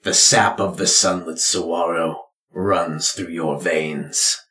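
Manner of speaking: grave ritual pronouncement; solemn father. Acted. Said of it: "your father speaks".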